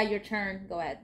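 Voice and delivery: ordinary talk, nothing marked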